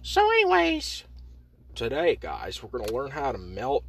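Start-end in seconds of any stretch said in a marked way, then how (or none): 0.0-1.0 squeaky voice. Acted. Repeats squeaky voice bit.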